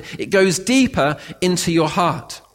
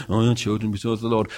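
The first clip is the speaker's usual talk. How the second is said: fast, lower, offhand reading; dismissive quick read; 7 semitones lower, much faster than usual. Shown as a demonstration. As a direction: rattle it off without caring.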